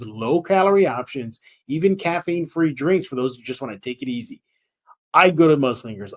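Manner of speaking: upbeat radio ad voice; ad read. Acted. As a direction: sell it like a local radio spot. Host reads sponsor ad.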